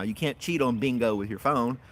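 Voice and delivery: usual speaking voice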